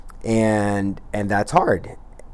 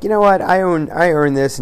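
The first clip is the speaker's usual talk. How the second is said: much higher, louder mock entitled voice; self-justifying lizard brain; 8 semitones higher, 5 dB louder than usual. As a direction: talk yourself into a treat, indignant. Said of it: "the negative brain, the lizard brain".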